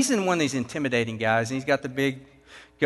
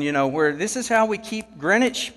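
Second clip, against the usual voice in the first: ordinary delivery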